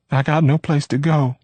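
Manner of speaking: ordinary delivery, nothing marked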